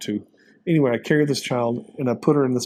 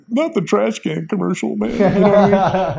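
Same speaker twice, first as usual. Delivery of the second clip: higher, laughing through the joke; 8 semitones higher, 6 dB louder than usual. Real, not acted. To the host about a commercial, laughing. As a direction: chuckle the aside out high.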